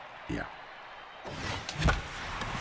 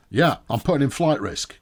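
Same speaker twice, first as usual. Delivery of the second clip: usual speaking voice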